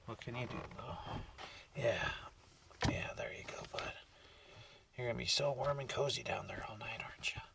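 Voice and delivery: soft unvoiced whisper to the dog; hushed whisper to the dog lying below. Real, not acted. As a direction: whisper him to sleep.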